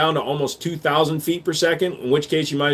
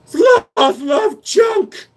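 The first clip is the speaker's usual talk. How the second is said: slow, high shouted creature cry; hammed-up sloth voice; 5 dB louder, much slower than usual. Streamer answers whether sloths shoot guns, high shouted creature voice. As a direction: bellow it high and drawn out.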